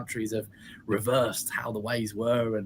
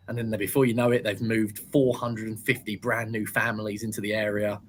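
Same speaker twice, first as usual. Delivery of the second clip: normal talking voice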